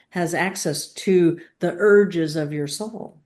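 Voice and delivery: ordinary delivery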